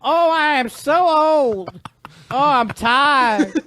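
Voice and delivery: put-on high, slow old man voice; creaky old man, slow and whiny. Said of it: "Do one of your funny voices".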